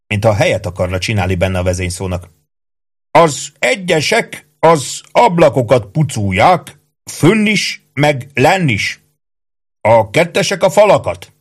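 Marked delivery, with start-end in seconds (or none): 3.1-11.3 high, slow sergeant voice; bossy sergeant Ike, giving orders. Acted. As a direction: hand out duties in a drawn-out command. (parancsolgató, magas, vontatott őrmesterhang)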